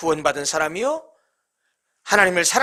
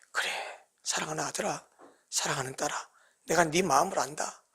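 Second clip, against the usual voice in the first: soft, low, embracing voice; God's tender reply; 5 semitones lower, 12 dB quieter than usual. Retold or imitated. God embracing his sons and daughters, soft tender comfort. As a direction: comfort a child gently. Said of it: "하고 우리를 품어주시는 분이 하나님".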